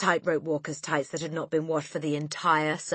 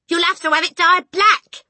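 high, loud firm wife; loud instruction; 13 semitones higher, 12 dB louder than usual. A narrator's reading. Mother advising husband, loud firm instruction. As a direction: tell him forcefully.

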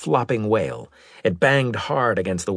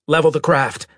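raised, firm order; commanding; 7 semitones higher, 5 dB louder than usual. A narrator's reading. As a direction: give the pilot an order, pitched up and louder. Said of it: "said in a commanding voice".

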